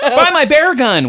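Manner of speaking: high, loud, drawn-out holler; Chris Gordon. Retold or imitated. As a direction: hawk it in a hammed-up comic voice. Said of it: "a great Chris Gordon impression".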